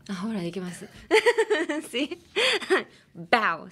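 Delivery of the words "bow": bow with a punched B, louder; bow, B hit hard; stress on the B. Shown as a demonstration. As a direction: pop the B of bow. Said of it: "just emphasis on the B".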